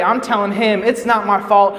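usual speaking voice

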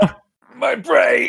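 high-pitched pained groan; mock agony. Friend pained by another's dumb question, high mock agony. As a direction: wail it in exaggerated pain.

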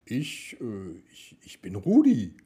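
put-on stammering boy, startled. A narrator's reading. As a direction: stumble over the words in fright. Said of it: "antwortete er erschrocken".

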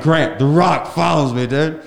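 high, loud re-enacted excitement; excited announcement. Comic phoning his friend about The Rock, excited. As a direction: burst out with unbelievable news.

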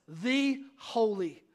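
slow, drawn-out snarl with a slight hint of menace; demon's snarl. Pastor voicing the demon who recognises Jesus, slow mild snarl. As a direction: sneer it out slowly, lightly voiced demon. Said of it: "a snarl in the man's voice".

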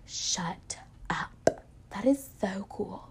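awed hushed whisper, slow; amazed whisper. Retold or imitated. Friend hearing about the gap year, awed whisper. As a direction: breathe it out in wonder.

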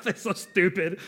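scoffing through laughter; laughing scoff at a weepy movie; mocking while laughing. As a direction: sneer at the sad movie, half laughing. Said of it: "I'm like".

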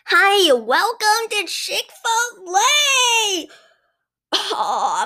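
high, slow, sing-song cashier voice; overly cheerful cashier. Acted. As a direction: gush like a too-sweet fast food worker. Sugary fast-food cashier voice.

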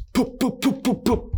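little cartoon pops; kiwi launch noises. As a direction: mimic kiwis shooting out in a row. Kiwis firing.

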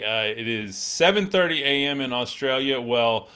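usual speaking voice

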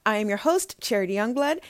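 ordinary talk

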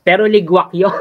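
chuckling ligwak; laughing verdict. Real, not acted.

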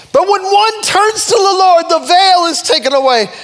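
high, loud, driving proclamation; full-force preaching. Real, not acted. Preacher urges turning to the Lord, full-force preaching. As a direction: cry out each phrase, then let its end fall.

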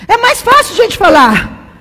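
high, loud, crying preacher's plea; tearful preaching cry. Real, not acted. Preacher crying out to the congregation about sinners, loud and tearful. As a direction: cry it out from the pulpit, voice breaking.

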